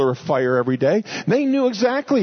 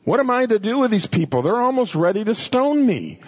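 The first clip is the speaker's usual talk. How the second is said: crying out; 7 semitones higher than usual. Retold or imitated. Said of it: "Moses cried out to the Lord".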